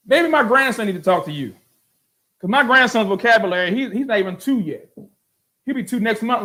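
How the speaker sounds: high-pitched, mocking disbelief; incredulous rant. Real, not acted.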